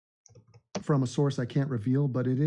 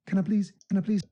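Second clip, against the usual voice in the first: put-on higher-pitched, quick London-accent plea; 5 semitones higher, faster than usual. Streamer asking for subscribers, put-on London accent. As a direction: beg politely in a London accent.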